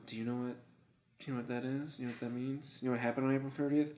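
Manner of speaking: soft passive-aggressive quizzing; Rose. Acted. Girlfriend tests whether he remembers the date, softly passive-aggressive. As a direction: needle quietly, question after question. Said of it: "Rose is passive aggressive as fuck".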